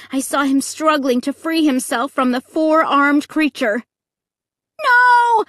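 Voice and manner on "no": high, loud scream of terror. A narrator's reading. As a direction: scream it out in fright. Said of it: "I screamed".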